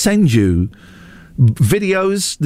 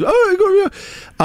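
put-on much higher, slow, annoying fourteen-year-old boy; high-pitched boy voice; slower than usual. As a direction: squeak it out like an annoying young teen. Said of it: "quite a high-pitched, like, an annoying".

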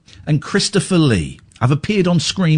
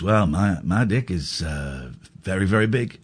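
lower, softer, boastful Leon; Leon impression; 11 semitones lower, 7 dB quieter than usual. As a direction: brag like sweary Leon, low and soft. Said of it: "Leon goes".

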